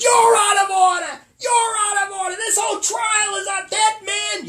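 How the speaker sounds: screaming high and loud; furious courtroom rant. Retold or imitated. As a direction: lose it completely in court. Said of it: "He loses it".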